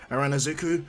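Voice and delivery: ordinary delivery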